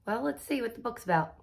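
plain reading voice

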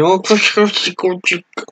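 put-on high, slow, dreamy swoon over a boy; swooning anime girl. Imitating the ice-cream girl gushing over a boy, high and slow. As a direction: melt over him like a smitten schoolgirl. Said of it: "Y aquí está en plan de".

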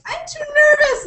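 high, loud whiny squeal; mock crying whine. Mimicking the annoying heroine who cries, in mock whine. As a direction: squeal it like a hammed-up crybaby. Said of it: "and starts crying".

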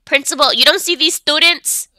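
ordinary talk

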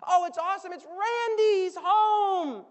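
high, loud, almost crying with joy; overjoyed servant. Acted. Servant announcing the brother is home, overjoyed. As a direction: gush it, voice cracking with happiness.

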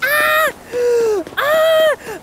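short high, loud shrieks; two yelps of excitement; excited squeal. Real, not acted. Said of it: "trying not to freak out".

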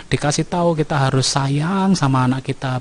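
ordinary talk, nothing marked

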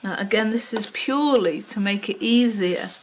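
ordinary talk, nothing marked

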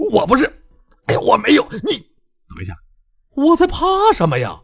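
high, loud flustered babble; flustered yelping. A narrator's reading. Bai Jianfei flustered under attack, high and loud. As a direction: stammer in panic, then talk yourself brave. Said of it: "白剑飞本能的鬼叫躲闪".

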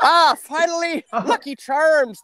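high, loud, drawn-out delight; mock relief. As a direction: exclaim it with hammed-up relief. Acts out Irish shopper's relief.

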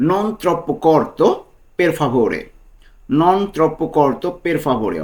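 very slow "Non troppo corto", each word stretched out; slow model phrase. Shown as a demonstration. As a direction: drawl it out word by word for the learner. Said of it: "এর Italian কী হবে?".

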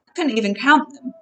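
ordinary delivery, nothing marked

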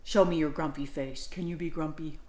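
low, soft grumpy voice; playful low grump. Acted. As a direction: grumble it low for the kids. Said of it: "dinosaur grumpy".

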